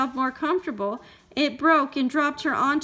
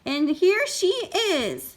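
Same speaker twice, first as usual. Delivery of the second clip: high, slow little bear voice; Baby Bear cry; 7 semitones higher, 3 dB louder, slower than usual. A narrator's reading. Baby Bear finding Goldilocks in his bed, high slow cry. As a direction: draw it out high like an astonished cub. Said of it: "cried Baby Bear".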